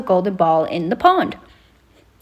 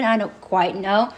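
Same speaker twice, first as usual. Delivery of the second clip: ordinary talk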